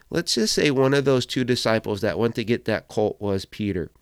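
normal talking voice

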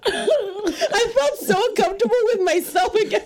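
high-pitched, words breaking up in laughter; laughing confession. Real, not acted. Gym-goer admitting she stared at coach's sweats, laughing confession. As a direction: wail it out through helpless laughter. Confessing embarrassment while cracking up.

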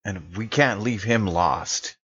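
normal talking voice

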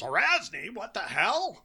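startled outburst, pitch jumps up; surprised. Retold or imitated. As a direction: blurt it out in shock. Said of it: "Kishikish goes".

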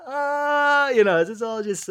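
high, loud mocking scoff; a high, loud voice waving it all away; scoffing dismissal. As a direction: brush it off like a know-it-all skeptic. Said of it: "I'm like".

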